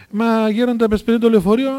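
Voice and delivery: high, loud retold plea; anxious protest. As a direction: protest urgently to the elder. Acted anxious protest to elder.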